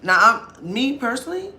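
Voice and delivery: ordinary talk, nothing marked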